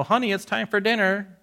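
lightly voiced wife calling out; a hint of Bathsheba calling him in; mild wife voice calling to dinner.

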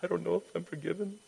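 small, high, soft mock voice; wounded doubter, mimicked. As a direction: play the wounded believer, quick and timid. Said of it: "walking around like the wounded".

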